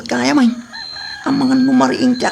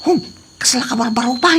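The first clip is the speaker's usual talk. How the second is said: normal talking voice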